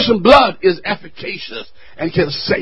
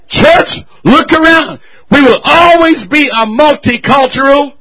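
loud, high impassioned preaching; fiery sermon climax; full-force preaching; 6 semitones higher, 7 dB louder than usual. Real, not acted. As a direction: preach it to the back row.

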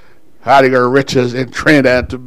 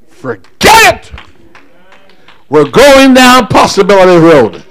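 loud, higher-pitched preacher's cry; full-force preaching; 6 semitones higher, 6 dB louder than usual. Real, not acted. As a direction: fire up the congregation with a ringing voice.

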